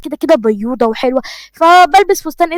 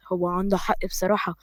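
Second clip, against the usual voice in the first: much softer, barely voiced, to herself; 6 semitones lower, 13 dB quieter than usual. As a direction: murmur it under your breath. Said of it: "ضحكت في سرها وقالت". (كلام في سرها بصوت واطي جدًا)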